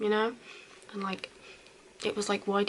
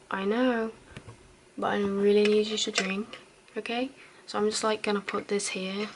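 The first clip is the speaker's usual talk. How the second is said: caring roleplay. Acted. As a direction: gently coax a drunk friend to drink water. Caring for a drunk friend.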